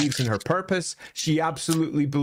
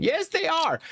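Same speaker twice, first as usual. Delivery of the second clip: put-on high, insistent child's protest; stubborn young Marathi insisting; defiant little girl. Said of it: "little Marathi is left by herself saying".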